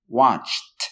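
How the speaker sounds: "Watched" as one syllable ending in a crisp t sound; correct English pronunciation. Shown as a demonstration. Teacher modeling "Watched" for Spanish speakers with a clear final t. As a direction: say "Watched" with the tongue released on a final t, no extra vowel. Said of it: "Así que sería de esta forma".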